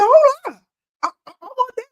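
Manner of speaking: high, eager interruption; excited. Retold or imitated. Hearing her friend's secret, eager high excitement. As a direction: grab the idea like you need it now.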